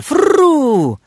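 long, loud, high "frrrru"; whirring take-off noise. A narrator's reading. Narrator mimics the sparrow escaping the dog, whirring noise. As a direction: draw out a whirr of wings, rising in volume.

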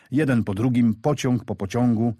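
ordinary delivery

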